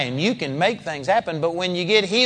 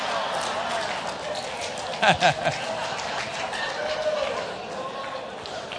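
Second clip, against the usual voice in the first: breathy laugh; 10 dB quieter than usual. Real, not acted. Preacher laughing.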